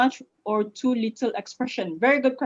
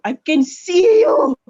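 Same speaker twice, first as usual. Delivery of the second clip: excited; 12 semitones higher, 9 dB louder than usual. Real, not acted. Delighted at clear video.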